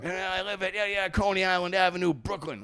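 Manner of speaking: high-pitched mock-proud declaration; proud roommate. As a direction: imitate a roommate proudly giving details. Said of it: "Like, he's proud".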